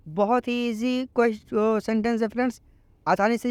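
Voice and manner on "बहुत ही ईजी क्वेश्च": usual speaking voice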